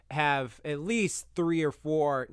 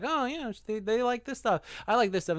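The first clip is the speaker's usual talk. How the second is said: higher, chirpy voice of someone spotting a shared interest; mock excited discovery; 8 semitones higher than usual. Guy scrolling her feed, mock excited. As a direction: pitch it up like you just noticed something you like.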